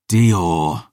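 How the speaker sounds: ordinary delivery, nothing marked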